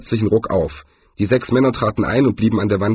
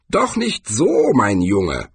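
put-on old man chiding; slower than usual. Cohen corrects young swordsman.

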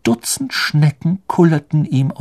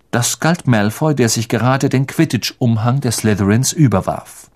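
plain reading voice

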